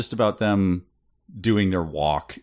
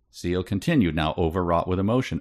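ordinary delivery

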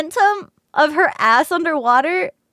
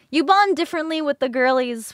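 ordinary talk

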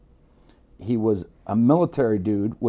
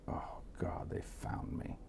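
dread; 4 semitones lower, 17 dB quieter, slower than usual. Retold or imitated. Retold dread as police knock.

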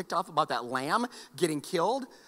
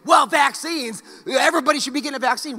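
higher, louder mock preaching, rushing the end; mock soapbox rant; 7 semitones higher, 8 dB louder than usual. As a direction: imitate a pushy opinion-pusher, fast at the end. Mocking a soapbox opinion.

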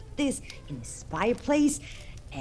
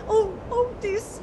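much higher, loud alarmed cries; shocked; 4 dB louder than usual. Acted. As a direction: cry out at the sight. Narrator shocked.

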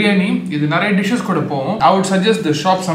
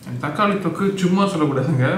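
speaking with food in mouth; talking between bites; chewing while talking; faster than usual. Real, not acted. Seen 0: Bites at -2 and -1; mouth mostly closed while talking until 1.9.

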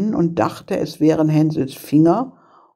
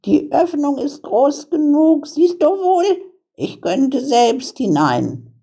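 high-pitched, impatient witch; scolding old witch, pitched way up; exasperated witch; 11 semitones higher than usual. A narrator's reading. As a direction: show the girl up in a high witch voice. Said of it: "sagte die Alte".

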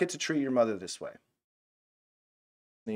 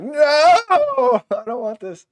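put-on high wailing child's plea, close to tears; child begging through tears, loud and high; scared child crying; 13 dB louder, much slower than usual. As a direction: cry out like a kid about to be spanked. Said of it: "seen a child who knows they're gonna get a spanking".

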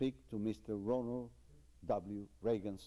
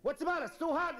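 high, raised voice of alarm; concerned doctor; 3 dB louder, faster than usual. Acted. Doctor asking the yelping patient what's wrong, high and urgent. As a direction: ask in a hurry what's wrong.

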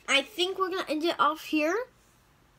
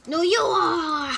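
mock shout; 4 semitones higher, 9 dB louder than usual. Playful retort, shouted.

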